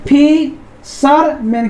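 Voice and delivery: Fisherman slowly, pausing between फि, शर, मैन; three long separated beats on fisherman; split into syllables. Shown as a demonstration. Teacher showing students three syllables, split into syllables. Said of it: "तीन syllable".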